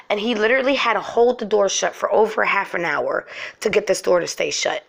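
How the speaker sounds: usual speaking voice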